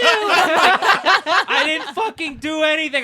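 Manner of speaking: loud, high, drawn-out protest; hammed-up outraged denial at top volume; acted indignant shouting. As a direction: yell back like a wrongly blamed kid. Acts out shouting back at mom.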